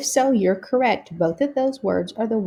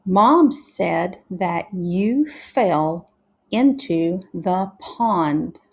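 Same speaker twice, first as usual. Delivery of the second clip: slow beginner reading, breaking words into sounds; practicing together; much slower than usual. Shown as a demonstration. Teacher practicing sentences together with pupils, slow sounded-out reading. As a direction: read word by word, blending "fell" and "pond". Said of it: "let's practice together now".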